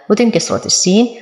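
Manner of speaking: ordinary talk, nothing marked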